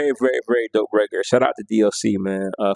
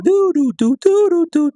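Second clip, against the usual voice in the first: much higher, louder singsong imitating the remix synth; weird techno sounds; 5 dB louder than usual. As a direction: chirp the electronic intro way up high. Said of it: "all that weird stuff".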